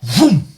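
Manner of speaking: shouted engine vroom; loud vroom. Pretend. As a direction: belt out a vroom like a revving engine. Kicking trolls out of chat.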